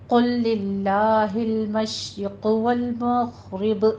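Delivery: slow melodic recitation; chanted recitation; Quran recitation. Shown as a demonstration. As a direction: recite the line calmly and slowly. Recites the verse whole.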